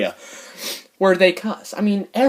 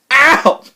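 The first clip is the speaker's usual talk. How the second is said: loud, high "ow"; yelp of pain; 9 semitones higher, 9 dB louder than usual. Real, not acted. Vlogger poking his own eye, loud pained yelp. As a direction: yelp as if poked.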